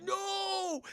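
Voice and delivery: high, long mock wail; anguished cry. Robot grabs Nelson's car, he wails. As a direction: cry out in exaggerated despair.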